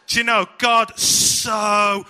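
"God so" drawn out high and loud, slowed right down; slow booming preacher emphasis; emphatic slow stress. Acted. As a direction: lean hard on "God" like a preacher. Preacher stressing the key words.

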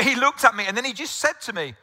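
normal talking voice

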